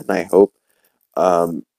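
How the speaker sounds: ordinary talk, nothing marked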